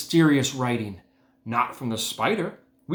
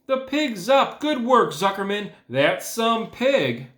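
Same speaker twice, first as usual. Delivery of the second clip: higher, louder cheering, slowing at the end; excited crowd cheer; 11 semitones higher, 5 dB louder, slower than usual. A narrator's reading. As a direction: celebrate the pig like a thrilled crowd. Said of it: "yelled the crowd".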